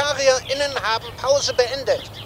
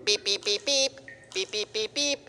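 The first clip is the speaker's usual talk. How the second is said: soft electronic peeping; tiny quiet beeps; peeping baby chick; 2 semitones lower, 7 dB quieter than usual. Acted. Electronic newt signals.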